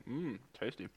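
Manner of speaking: soft murmur while chewing; mouth full. Real, not acted. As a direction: savour it with food in mouth. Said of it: "Sorry, I'm still eating".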